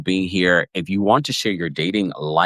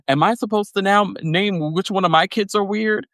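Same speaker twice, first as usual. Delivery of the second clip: much higher, put-upon imitation; mock Kyle; 12 semitones higher than usual. Retelling Kyle asked to name a weird kid, much higher mock dismay. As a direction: sound cornered and incredulous. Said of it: "poor Kyle is like".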